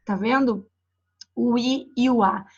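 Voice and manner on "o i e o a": each vowel O, I, E, O, A sounded out separately and clearly; vowel sounds demo. Shown as a demonstration. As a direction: sound out "O i e o a" one vowel at a time. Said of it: "Cada barrinha dessa representa". (vogais pronunciadas uma a uma, bem separadas)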